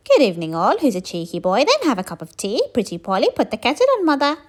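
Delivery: loud squawky parrot chatter. A narrator's reading. Parrot skeleton greets the visitors, in a loud parrot voice.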